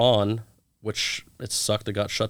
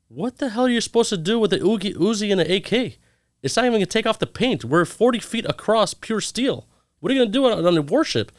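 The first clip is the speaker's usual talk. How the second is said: incredulous; 11 semitones higher than usual. Retold or imitated. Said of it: "I said".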